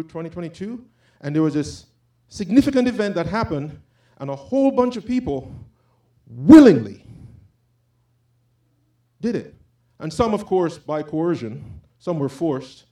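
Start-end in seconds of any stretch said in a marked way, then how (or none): 6.3-7.0 willingly punched hard and loud; willingly slammed, then a fall; stress on willingly. Real, not acted. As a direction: hit the word willingly with force. Preacher punches key word.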